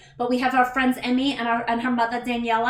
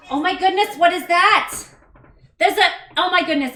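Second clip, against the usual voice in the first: raised, louder astonished outburst; startled delight, pitched up and loud; real surprise; 7 semitones higher, 9 dB louder than usual. Real, not acted. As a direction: exclaim as if caught completely off guard. Surprised by a hype train.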